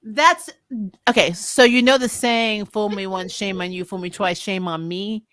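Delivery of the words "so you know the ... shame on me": ordinary delivery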